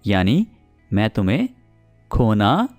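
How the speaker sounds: ordinary talk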